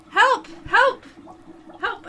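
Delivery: high loud calls for help; shouting for help. Acted. Dex fleeing the lab yelling for help. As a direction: yell down the hall for anyone.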